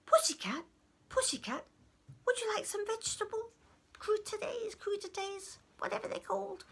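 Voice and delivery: high, quick goblin offer; high-voiced wheedling goblin; coaxing goblin. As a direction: coax a cat with food, pitch climbing. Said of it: "And he came up and said".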